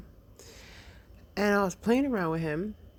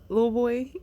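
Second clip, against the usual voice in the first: playful Trinidadian lilt, pitched higher and louder; Trinidadian accent; 7 semitones higher, 6 dB louder than usual. Retold or imitated. Mother teasing her son at bedtime in a mock Trinidadian accent. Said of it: "I'm like this Trinidadian woman".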